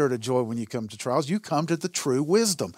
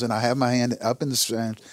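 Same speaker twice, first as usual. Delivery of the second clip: normal talking voice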